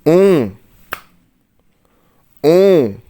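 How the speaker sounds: اون with rounded nasal vowel, no n; loud nasal demo. Shown as a demonstration. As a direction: project اون clearly for the class. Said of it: "اللي هو".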